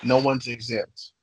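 ordinary talk

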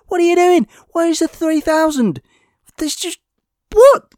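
incredulous outburst. Real, not acted. Reviewer yelling at the filmmakers over three thousand cuts, high and incredulous. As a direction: shriek the question, baffled and fed up.

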